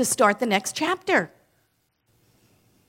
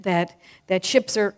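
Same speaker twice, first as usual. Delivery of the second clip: usual speaking voice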